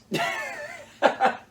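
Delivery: high breathy burst of laughter; real laugh. Real, not acted. Storyteller laughing at the hunter who soiled himself, bursting laugh. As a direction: crack up at your own story.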